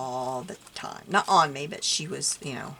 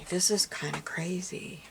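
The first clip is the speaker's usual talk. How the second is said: usual speaking voice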